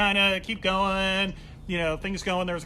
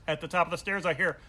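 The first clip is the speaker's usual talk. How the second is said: normal talking voice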